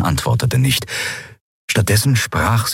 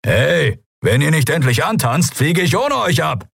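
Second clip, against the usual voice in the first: impatient pilot; 10 semitones higher than usual. Acted. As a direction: hurry them up gruffly. (ungeduldiger Ruf)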